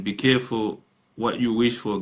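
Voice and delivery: ordinary delivery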